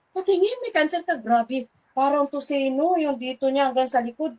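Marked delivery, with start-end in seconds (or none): 0.1-1.2 shocked question. Retold or imitated. Shocked reaction to woman's cancer.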